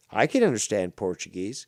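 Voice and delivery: usual speaking voice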